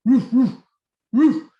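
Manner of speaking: dog answers the call; dog barking. Acted. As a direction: bark like an eager dog. Dog barks.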